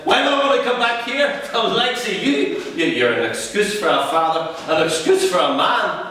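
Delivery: son telling dad he's an excuse for a father, contemptuous; contemptuous outburst. Acted.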